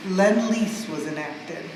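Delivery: ordinary delivery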